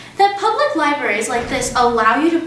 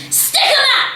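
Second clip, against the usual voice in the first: bold shout; 13 semitones higher than usual. A narrator's reading. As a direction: play the hero bursting in. Matilda charging in.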